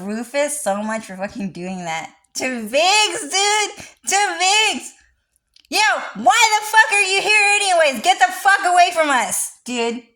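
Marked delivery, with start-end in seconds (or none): 2.3-10.0 high, loud, fast jeering yell; mock brash shout. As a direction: heckle them like a cocky gang leader. Quoting game character Rufus' line.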